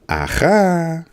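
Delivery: high, drawn-out movie aha; a quick Eddie Murphy style aha; playful high aha. Retold or imitated. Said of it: "Eddie Murphy Coming to America".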